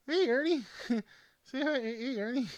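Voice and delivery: high, sing-song voice for a toy; pet talk. Pretend. Greeting the plush toy Ernie, high cooing pet talk. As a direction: coo up high like talking to a pet.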